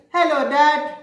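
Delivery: put-on high, loud little-boy hello; cheerful child calling to his dad; little boy greeting. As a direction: chirp it up like an eager small child. Bernard greets dad again.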